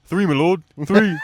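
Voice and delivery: high, loud mock-henchman cry; movie henchman impression. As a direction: call it out to your lord, hammed-up. Quoting henchman from Shrek film.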